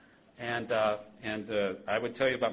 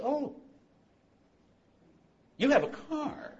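much higher, hammed-up astonished God; mock surprise; slower than usual. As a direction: feign shock at the news. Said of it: "And God goes".

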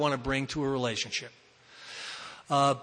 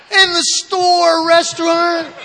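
high, loud, slow disbelief; a disbelieving yelp at the news; incredulous; 12 semitones higher, 10 dB louder, slower than usual. Retold or imitated. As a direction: stretch it out in shocked disbelief. Incredulous retold reply to girlfriend.

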